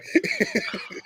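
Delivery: airy wheezing laugh; breathy high laugh. Real, not acted. Laughing at story.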